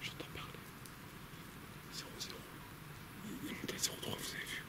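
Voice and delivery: tense hushed whisper. Real, not acted. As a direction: whisper as if something listens. Hearing voices at night, hushed. (chuchotement tendu)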